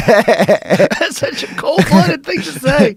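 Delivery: high, loud and drawn out through laughter; delighted, laughing disbelief; laughing hard. Real, not acted. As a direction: crack up while speaking, slowly. Cracking up at a brutal quote.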